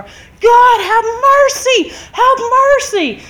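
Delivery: loud, high, drawn-out cry to God; reenacted desperate prayer shout; screamed plea. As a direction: wail it slowly at the top of your voice. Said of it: "As loud as I could, I started screaming".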